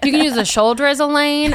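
hammed-up mock-helpful local; exaggerated cartoon intonation. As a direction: sell bad advice with cartoon bounce. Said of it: "repeats friend's line in character".

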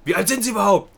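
high, loud mock interrogation; indignant clerk. Clerk challenging the trainee's age, mimicked loud indignation. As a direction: fire it off as an outraged clerk.

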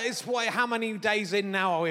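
ordinary delivery

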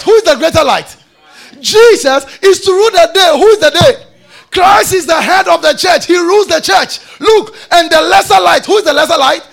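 fervent pulpit shouting; full-force preaching. Real, not acted. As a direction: fire out questions and shout the answers.

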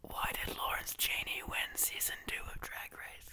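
ASMR whisper into the mic; sweet nothings. Acted. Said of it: "We are going to whisper sweet nothings".

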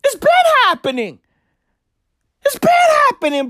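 rising, heated insistence; growing exasperated insistence; insistent. Retold or imitated. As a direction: argue it's nothing new. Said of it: "I was like".

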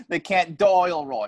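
drawn-out Aussie "dial"; exaggerated Australian vowels; mock Australian accent. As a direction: stretch "dial" toward "doyle" in Australian. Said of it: "The Australian accent is an annoying accent".

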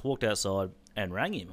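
ordinary talk, nothing marked

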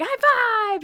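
high, loud triumphant cheer; big drawn-out celebratory shout; crazy celebrating. Retold or imitated. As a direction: cheer wildly for a toddler's success. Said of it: "you'd celebrate it like crazy".

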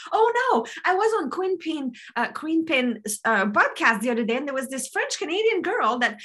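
high, hurried fretting; hammed-up worried realization; mock alarm. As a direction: play someone realizing their mistake, quickly. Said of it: "And you're like".

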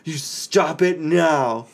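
slow, stretched-out stern order; angry command. Retold or imitated. Dad so mad at his kids, stern warning. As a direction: bear down on each word, mock-furious. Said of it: "I'm so incredibly angry".

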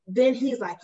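usual speaking voice

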